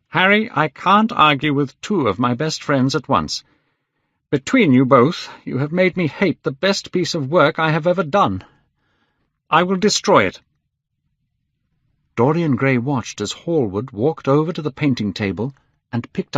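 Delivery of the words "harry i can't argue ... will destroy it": high, forceful quarrelling; angry outburst. A narrator's reading. Painter to both friends about the portrait, angry. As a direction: build to a high, hard threat.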